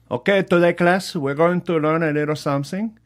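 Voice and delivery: high, bright teacher voice; perky teacher. Retold or imitated. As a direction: call the class to order. Spanish teacher addressing class.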